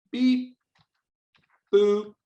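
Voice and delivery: high robotic bleeps; computer voice. Pretend. Teacher voicing a computer's greeting for kids, high computer voice. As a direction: bleep it high, each sound on its own. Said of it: "here's how I'm gonna do computer voice".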